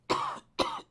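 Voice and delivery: harsh tickly cough; dry cough. Real, not acted. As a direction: cough from the back of the throat. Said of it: "It's on the back of my throat".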